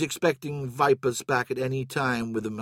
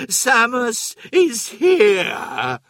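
very high, very slow, loud and eerie creature voice; monstrous drawl; 11 semitones higher, 7 dB louder, much slower than usual. A narrator's reading. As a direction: stretch every word out like a gloating monster. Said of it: "he drawled".

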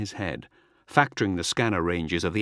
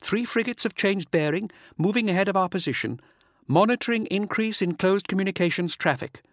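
high-pitched female officer, composed report; raised voice, calm and efficient, lifting on the address; quick but calm; 9 semitones higher than usual. A narrator's reading. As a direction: report briskly to your lord without alarm. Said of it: "her words quick but calm".

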